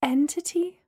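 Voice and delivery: small, high squeak; high-pitched, rising query; squeaked question. A narrator's reading. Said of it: "I squeaked".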